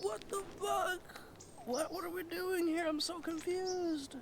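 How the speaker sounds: very high, drawn-out confusion; squeaky, hammed-up bafflement; bewildered squeal. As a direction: whine it in high mock disbelief. Retells his utter confusion.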